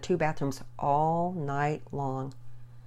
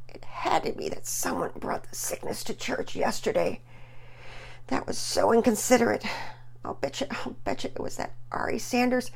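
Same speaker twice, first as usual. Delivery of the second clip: half-whispered grumbling, then higher; muttering; 8 semitones higher than usual. Acted. Sick boy blaming a classmate, muttered grumble. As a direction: grumble it under his breath. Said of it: "Pearson muttered".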